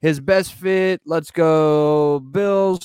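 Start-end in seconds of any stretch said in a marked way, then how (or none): 1.1-2.8 slow, loud fan chant; cheering. Real, not acted. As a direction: cheer the team on. Cheering for his team.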